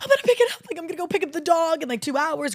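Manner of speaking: hyped high-pitched impression of him; imitating excited boyfriend. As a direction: squeal it like an overexcited kid. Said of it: "He's so fucking excited".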